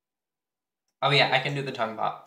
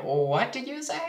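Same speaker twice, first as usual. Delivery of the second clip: much higher put-on voice of the boy; mock classmate; 11 semitones higher than usual. The rude classmate challenging her, a mocking high voice. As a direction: mimic him acting offended. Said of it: "And he was like".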